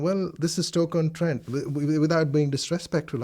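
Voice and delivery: ordinary delivery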